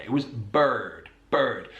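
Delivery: "bird" with a strong R, slowly; slow rhotic West Country bird; West Country accent. Shown as a demonstration. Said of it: "how she said bird".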